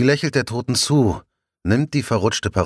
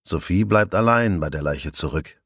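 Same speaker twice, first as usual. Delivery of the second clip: ordinary delivery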